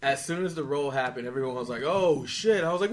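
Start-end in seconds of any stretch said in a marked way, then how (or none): 1.8-2.6 loud, higher mock amazement; retold crowd exclamation. Retelling the roulette crowd's reaction, retold crowd exclamation. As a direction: exclaim it like the whole table.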